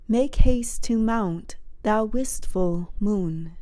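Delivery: usual speaking voice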